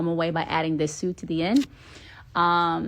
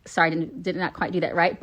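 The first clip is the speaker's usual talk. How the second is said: ordinary talk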